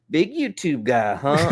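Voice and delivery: slow, high teasing drawl; mocking tease. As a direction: needle him again, grinning. Project manager teasing again.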